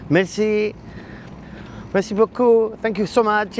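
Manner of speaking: lightly put-on French accent, high; chirpy French words, said like a local. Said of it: "you talk the lingo like one of the locals".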